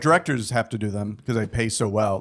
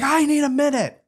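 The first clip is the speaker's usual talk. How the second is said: angry director; 5 dB louder than usual. Retold or imitated.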